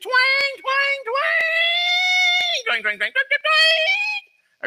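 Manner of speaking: very high mimicked twanging; imitated guitar twang. Preacher mocking a musician from a clip, high imitated twangs. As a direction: squeal out mock guitar notes, leaping way up in pitch. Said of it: "he was doing his stuff".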